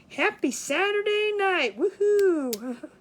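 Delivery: party whoop; festive cheer. Real, not acted. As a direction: cheer it out slowly to everyone. Cheering the start of Saturday night.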